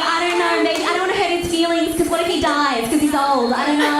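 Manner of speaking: high-pitched, anxious fretting; hammed-up insecure worrier; insecure dithering. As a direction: fret aloud in a high, insecure voice. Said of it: "I'm insecure".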